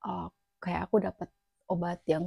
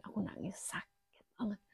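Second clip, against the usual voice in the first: soft whispered pain; crying whisper; 11 dB quieter than usual. Real, not acted. Remembering crying from the pain, whispered. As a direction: whisper it like holding back tears.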